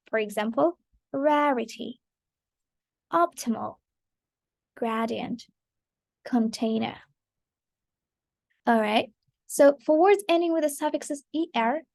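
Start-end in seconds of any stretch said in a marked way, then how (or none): 1.1-7.0 "rarity, optimal, gradient" weighted on the opening syllable; first-syllable stress. Shown as a demonstration. Teacher reading examples one by one, first-syllable stress on "rarity, optimal, gradient". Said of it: "the first syllable gets the stress".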